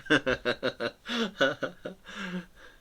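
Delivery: high giggling laugh. Real, not acted. Artist amused at talking to a nib, high giggle. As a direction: giggle high at your own joke.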